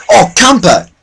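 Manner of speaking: high-pitched accusing shout; indignant yell. Real, not acted. Gamer calling out a camper, high indignant yell. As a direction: call out the camper in outrage.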